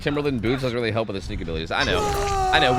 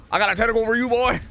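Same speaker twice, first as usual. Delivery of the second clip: high, raised-voice threat; taunting yell; 7 semitones higher, 4 dB louder than usual. Real, not acted. Streamer to hunted game opponent, taunting yell. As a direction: holler the taunt at your target. Repeats taunt, now yelled.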